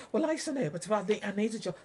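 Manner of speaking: high, loud whiny excuse voice; mocking worker. Vlogger mocks council workers' excuses, high and loud. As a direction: whine it like a jobsworth.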